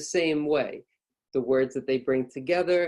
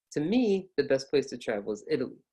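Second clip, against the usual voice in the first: "me" pitched up, the rest dropping to "Italy"; up on ME, down after. Shown as a demonstration. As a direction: lift "me", then come down for the rest. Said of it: "see how I go up".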